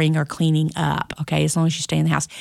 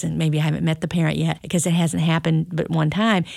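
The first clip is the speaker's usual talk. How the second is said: normal talking voice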